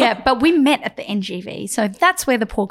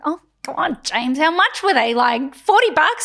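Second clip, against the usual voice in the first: higher, louder exasperated haggling; fed-up protest, pitched up; 7 semitones higher, 4 dB louder than usual. Retold or imitated. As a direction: argue it exasperatedly, half laughing by the end. Acted argument about ticket money.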